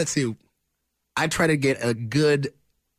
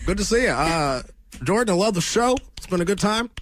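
raised Obama voice, warm and presidential; Obama impression; 7 semitones higher than usual. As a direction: greet the crowd like Obama. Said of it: "my co-host, Barack Obama".

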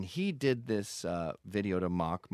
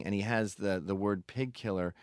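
usual speaking voice

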